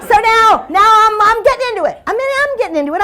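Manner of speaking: high, loud burst of excitement; mock giddy eagerness; hammed-up enthusiasm. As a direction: play getting swept up in it. Acting out growing enthusiasm.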